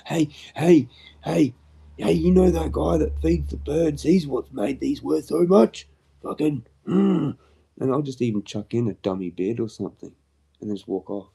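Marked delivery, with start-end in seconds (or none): 0.1-7.4 loud, high heckling call; mock shouting at auction. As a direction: yell it across the room to stir people up.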